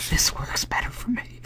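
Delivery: playful whisper; giggly prank whisper. Pretend. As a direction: whisper it with a grin. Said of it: "are we doing a whispering thing now?".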